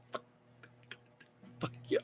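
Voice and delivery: normal talking voice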